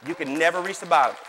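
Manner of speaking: loud, punched declaration; emphatic preaching. Real, not acted. Pastor driving home the depth of God's kingdom, emphatic preaching. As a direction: proclaim it with force.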